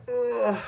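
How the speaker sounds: high, loud mock groan. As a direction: let out a hammed-up "ugh" as if knocked down. Falls down after being hit.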